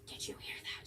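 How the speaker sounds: whispered question to a friend; hushed, secretive whisper. Retold or imitated. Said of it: "I was like".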